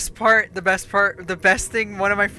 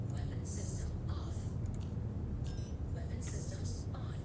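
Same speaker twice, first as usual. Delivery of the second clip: whispered; 2 semitones higher, 15 dB quieter than usual. Real, not acted. Reading out game system status.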